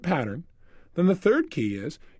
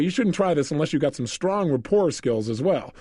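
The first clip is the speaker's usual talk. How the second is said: ordinary delivery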